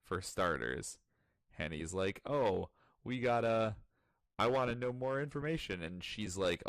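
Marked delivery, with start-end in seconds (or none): 2.2-5.8 lightly voiced eager boy, higher; pushing to know more; eager Raku. Retelling Raku begging for more information, lightly voiced.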